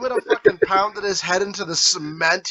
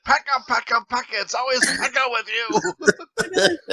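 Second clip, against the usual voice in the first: Grandma impression; 6 semitones higher than usual. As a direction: nag like an exasperated grandma. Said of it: "He's gonna channel Grandma".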